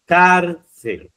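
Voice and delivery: "Cárcel" with a loud, high CAR and a falling "cel"; "Cárcel" split as CAR-cel, first syllable strong; stress on first syllable. Shown as a demonstration. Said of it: "Sílaba tónica, fijaros, es car".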